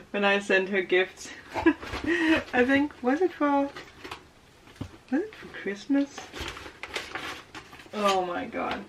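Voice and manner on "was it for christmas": ordinary delivery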